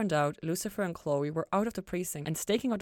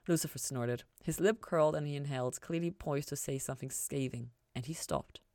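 usual speaking voice

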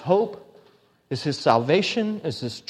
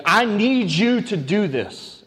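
slow, pleading plea to God; a hint of a desperate David; begging; 4 semitones higher, 4 dB louder, slower than usual. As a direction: beg him slowly, a bit higher and louder. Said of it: "it's almost like a begging".

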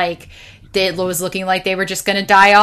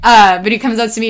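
usual speaking voice

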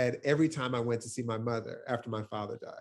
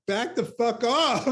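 higher, louder, slowed hammed-up snap; half-joking furious retort, raised and slowed; mock angry outburst; 11 semitones higher, 7 dB louder, slower than usual. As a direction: play your inner protective rage, a bit over the top. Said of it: "at all of which I'm like".

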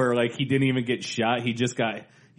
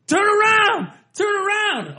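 high, loud heckler yell; mock yell; 7 dB louder than usual. Annoying theater guy heckling from the balcony, high loud yell. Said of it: "But the guy's yelling".

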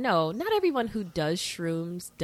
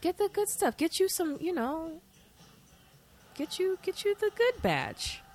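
silly high put-on voice; 11 semitones higher, 5 dB quieter than usual. Mocking talk about shroom dealers.

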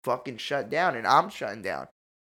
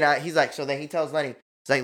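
ordinary delivery

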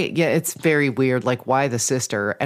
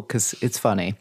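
ordinary talk, nothing marked

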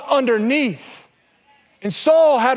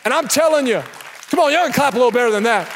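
ordinary talk, nothing marked

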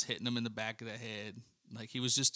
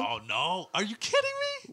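incredulous; 9 semitones higher, 4 dB louder than usual. Real, not acted. Incredulous at friend's claim.

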